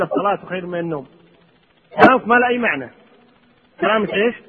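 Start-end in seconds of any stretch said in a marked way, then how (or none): 0.0-1.1 muttered back, much softer and lower; wrong listener reply, softer. Retold or imitated. Listener wrongly repeating the call, softly. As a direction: echo the call quietly as a mistake. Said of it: "بالك أنت تقول".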